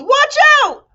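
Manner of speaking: very high, loud yell; yelled warning. A narrator's reading. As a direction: scream a warning. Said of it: "and then he yelled".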